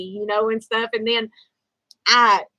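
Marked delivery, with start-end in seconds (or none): none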